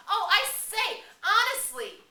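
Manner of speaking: very high, loud, outraged cry; indignant protest. Acted. The twins protesting as they are seized, indignant. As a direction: protest like proper schoolboys. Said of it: "Sam and Eric protested".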